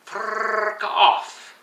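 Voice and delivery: frrrk with a long rolled R, high; frrrk, R rolled like a Scot. Shown as a demonstration. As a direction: trill the R in frrrk. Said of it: "roll your R's".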